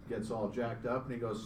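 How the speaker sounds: ordinary talk, nothing marked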